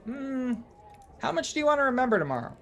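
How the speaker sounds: high-pitched little gnome voice, quick; gnomish barmaid. Acted. Gnome barmaid sizing up a customer's drink order, high gnome voice. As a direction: rattle it off high as a tiny gnome. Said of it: "She goes".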